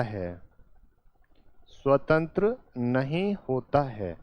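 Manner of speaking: slow, word by word with pauses; slow dictation. Shown as a demonstration. Teacher dictating the third point, slow and spaced. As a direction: spell it out slowly for note-taking. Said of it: "तीसरा point क्या हो जाएगा?".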